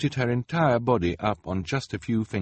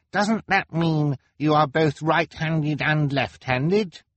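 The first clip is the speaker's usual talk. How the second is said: put-on high, slow, questioning child's voice; inquisitive boy; 9 semitones higher, 4 dB louder, slower than usual. A narrator's reading. Klaus asking Kevin about the word, inquisitive. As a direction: puzzle it out aloud, pitched well up.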